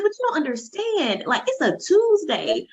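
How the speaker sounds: high, eager retelling of her plea; insisting, overjoyed. Retold or imitated. Telling her friend about the zoo, high and eager. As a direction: pitch it up, pleading to be understood. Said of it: "I'm like".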